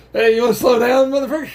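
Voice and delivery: high, loud yell; calling from far away. Acted. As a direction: shout at someone speeding past. Yelling at passing particle.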